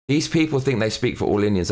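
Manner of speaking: ordinary delivery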